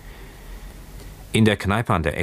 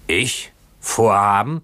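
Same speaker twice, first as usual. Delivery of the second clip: raised, higher-pitched echo of the question; shouted retort; 4 semitones higher, 4 dB louder, slower than usual. Acted. Drunken boatswain answering Keawe's question, shouted retort. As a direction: throw it back in a moderate shout. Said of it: "schrie der Bootsmann".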